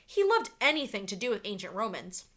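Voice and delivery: usual speaking voice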